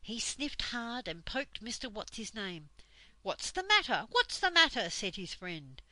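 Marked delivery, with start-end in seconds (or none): 3.2-4.9 high, loud, flustered repeated question; startled waking. A narrator's reading. Friend poked awake by saucepan man, startled. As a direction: jolt awake and demand what's wrong.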